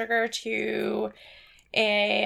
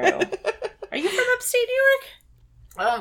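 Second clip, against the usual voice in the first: laughing; 14 semitones higher, 4 dB louder, much slower than usual. Real, not acted. Laughing after accent demo.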